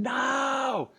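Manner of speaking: high, drawn-out cry of refusal; imitated scream. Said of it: "to watch him just screaming".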